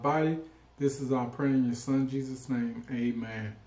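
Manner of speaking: soft, quick grace before a meal; prayer. Acted. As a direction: pray over the food softly. Leads blessing before the meal.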